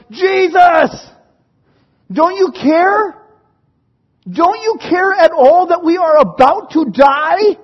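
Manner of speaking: high, loud frantic yell; panicked shout. Acted. Disciples shouting at sleeping Jesus, panicked yell. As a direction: scream it like you're sinking. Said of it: "they're like".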